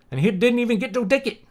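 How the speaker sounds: Scottish accent. Shown as a demonstration. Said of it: "He didn't even get a ticket".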